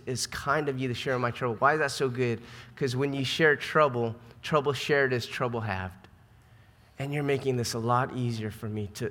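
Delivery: ordinary delivery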